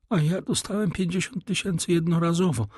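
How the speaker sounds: a hint of a pompous old Italian; old major. A narrator's reading. The major bluffing to his fake son, lightly voiced.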